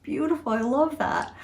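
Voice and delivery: retold choked-up admiration; imitating choked-up husband. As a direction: act moved by something sweet. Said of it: "really got choked up".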